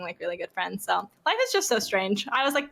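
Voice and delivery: ordinary talk, nothing marked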